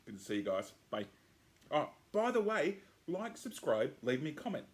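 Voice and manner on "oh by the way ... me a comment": excited. Real, not acted. Sudden outro reminder.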